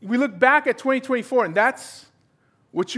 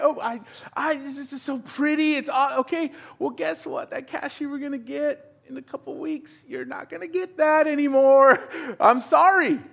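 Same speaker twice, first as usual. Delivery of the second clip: acted phone call; 6 semitones higher than usual. Acted dialogue with borrower.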